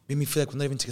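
ordinary delivery

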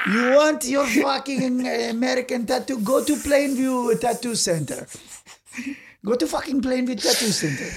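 annoyed tattoo artist. Acted. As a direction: send the customer packing in character. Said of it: "He's like".

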